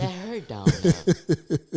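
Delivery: high, drawn-out pained whine with a laugh; mock pained squeal. To the host about the split, high laughing whine. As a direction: squeal it like it still hurts. Retelling painful split as a boy.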